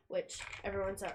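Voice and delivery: ordinary talk, nothing marked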